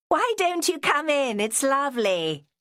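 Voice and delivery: calling out gaily from the water; Joanna calling. A narrator's reading. Joanna calling Vera into the tarn, playful.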